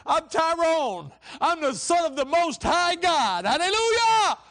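soaring, very loud preacher's shout; triumphant full-voiced declaration; out-loud proclamation. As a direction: shout it slowly to the rafters. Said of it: "I said it out loud".

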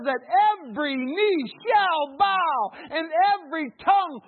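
high, loud, shouting sermon delivery; full-force preaching. Real, not acted. Preacher rousing the congregation, loud and high. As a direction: preach it out at the top of your voice.

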